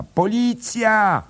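very high, loud, drawn-out cry; shrieking for police. A narrator's reading. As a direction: scream for the police. Bella calls police.

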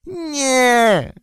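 high, piercing squeal; shrill shriek. Acted. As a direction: shriek it as if just stung. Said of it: "wrzasnął Golum piskliwie".

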